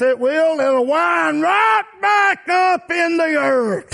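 high, long-drawn chanted cry; preaching climax shout. Real, not acted. Preacher declaring the body returns to earth, climactic shout. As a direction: stretch it out high and ringing.

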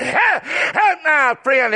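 ordinary talk, nothing marked

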